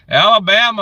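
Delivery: Alabama called out high and loud; happy greeting to an Alabama viewer; excited shout. Real, not acted. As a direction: cheer the state name out. Excited reading viewer's home state.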